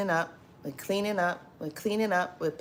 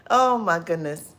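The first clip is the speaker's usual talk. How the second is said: normal talking voice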